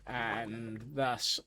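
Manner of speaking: ordinary talk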